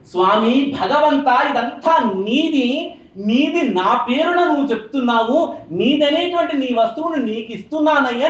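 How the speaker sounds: slow devotional offering prayer; slow, reverent prayer of offering. Shown as a demonstration. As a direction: slowly offer it all to the lord. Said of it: "అని నివేదన నైవేద్యము".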